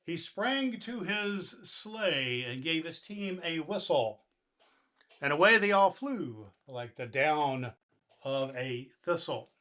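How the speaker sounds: put-on storybook reading to children; gentle bedtime poem reading; story narration. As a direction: read the Christmas poem warmly to kids. Santa reads the poem aloud.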